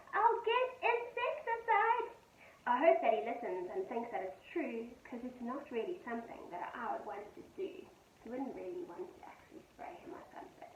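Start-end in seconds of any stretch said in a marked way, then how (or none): 0.1-2.1 shout. A narrator's reading. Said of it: "So I shout out the window". 2.7-7.9 gentle sing-song poem reading; poem read to children. A narrator's reading. Teacher reading a poem to her pupils, gentle and sing-song.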